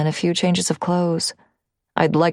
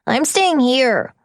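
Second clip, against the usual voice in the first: put-on higher, slow child voice, defiant; stubborn child; 8 semitones higher, 3 dB louder, slower than usual. Boy diving into closet, defiant child voice. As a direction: plant your feet like a stubborn kid.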